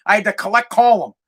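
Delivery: normal talking voice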